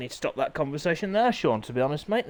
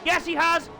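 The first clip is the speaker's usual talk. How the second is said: high, loud, thrilled race call; excited screaming commentary; 12 semitones higher, 4 dB louder than usual. Acted. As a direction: scream the crash like the crowd's on its feet. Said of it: "keep screaming like that".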